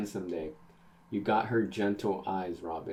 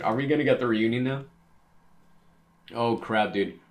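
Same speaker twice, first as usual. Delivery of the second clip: excited; 2 semitones higher, 6 dB louder than usual. Real, not acted. Hyped reader reacting to reunion.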